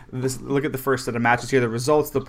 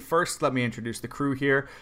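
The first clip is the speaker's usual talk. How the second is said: usual speaking voice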